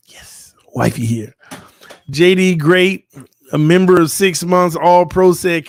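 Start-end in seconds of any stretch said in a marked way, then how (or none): 0.1-1.3 breathy hushed aside to the camera; whisper. Real, not acted. Husband whispering an intro of his wife to viewers. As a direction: whisper it so only the mic hears.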